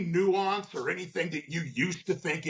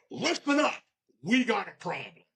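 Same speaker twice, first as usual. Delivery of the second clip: "Listen up" barked high, then back down; mock bossy steward; 5 semitones higher than usual. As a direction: play the chest-thumping steward storming into the office. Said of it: "you don't walk in and go".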